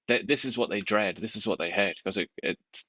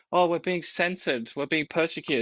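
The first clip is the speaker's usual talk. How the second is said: mock whining; 7 semitones higher than usual. Host mimics censored groups crying foul in a whine. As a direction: whine like a self-pitying victim. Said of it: "they're crying foul".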